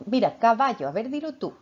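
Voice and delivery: put-on drilling a child. Shown as a demonstration. Mother wrongly presses her child to repeat the word, insistent. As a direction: demand the child say "caballo", insistent. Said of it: "ni tampoco intento decirle como".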